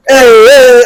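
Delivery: high, half-game "hey, hey"; awkward party call. Retold or imitated. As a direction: imitate forced cheering while dancing. Acting awkward dancing on stage.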